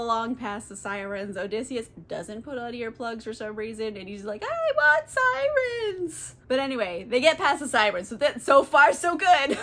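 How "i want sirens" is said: high, slow, crying wail of longing; whiny wail. Retold or imitated. Odysseus to the sirens, slow high whining cry. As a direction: wail it like a lovesick child, much higher.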